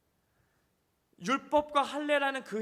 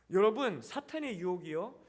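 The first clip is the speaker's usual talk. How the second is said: ordinary delivery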